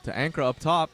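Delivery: brisk, loud courtside calling of each pass; excited sports commentator calling a basketball game; live play-by-play. Acted. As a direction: call the action as it happens.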